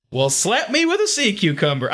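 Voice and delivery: high-pitched Southern exclamation; hammed-up Southern astonishment; southern accent. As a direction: exclaim it with a comic Southern twang. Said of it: "comes out in this southern accent".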